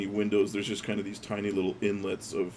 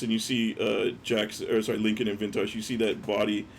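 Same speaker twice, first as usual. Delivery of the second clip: normal talking voice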